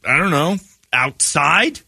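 lightly voiced awkward guy; hesitant question. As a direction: fumble for a topic. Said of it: "and he goes".

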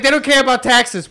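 high-pitched Boston barman impression; Boston accent. Bartender telling Larry the visitors don't care, Boston accent. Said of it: "had the thickest Boston accent in the world".

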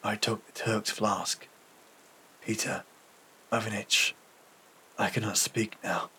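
soft, low, halting voice; weak and exhausted. A narrator's reading. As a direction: barely get the words out, pausing often. Exhausted wounded man can barely speak.

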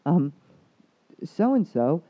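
high, loud, amazed retelling; wide-eyed, breathless disbelief; excited client. Retold or imitated. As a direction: act out her amazement at the change.

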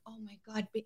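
hushed retelling of an overwhelmed husband; imitating choked up. Husband overwhelmed after a charity visit, choked-up murmur. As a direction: drop very soft, as if moved. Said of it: "choked up".

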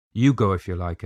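usual speaking voice

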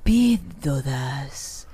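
slow sultry radio voice. Shown as a demonstration. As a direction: drag each word, very slow and low. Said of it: "es más".

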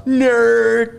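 high, loud jeering nerd; taunting a friend; mocking taunt. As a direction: sing out the insult to tease. Teasing name-calling.

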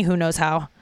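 ordinary delivery

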